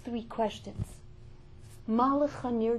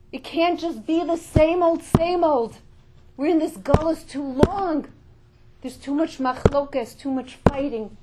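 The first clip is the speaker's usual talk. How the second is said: loud, higher, roaring sermon cry; preaching at full force; 5 semitones higher, 12 dB louder than usual. Acted. Preacher rousing the women to wake up, loud and fervent. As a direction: rouse the crowd like a roaring lion.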